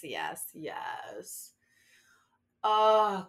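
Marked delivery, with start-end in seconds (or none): 0.0-1.5 breathy whispered yes. Acted. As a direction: whisper the yeses.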